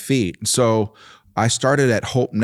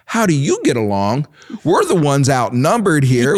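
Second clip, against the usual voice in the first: higher, louder mock protest; retold screaming; 5 semitones higher, 4 dB louder than usual. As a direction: play the outnumbered son's indignant yell, half-scale. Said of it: "I'm screaming behind her going".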